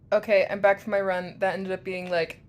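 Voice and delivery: normal talking voice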